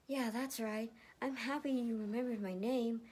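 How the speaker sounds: pleased and a little embarrassed; bashful teen voice. Acted. Matthew answering Erica, lightly high and bashful. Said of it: "Matthew blushed".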